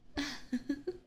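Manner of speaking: a rising, airy laugh of delight; airy teasing titter; breathy mocking giggle. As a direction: giggle at their naivety. Vampire amused by her captive.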